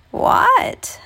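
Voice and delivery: very high whispered what; old woman's squeak. Acted. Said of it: "asked the old woman".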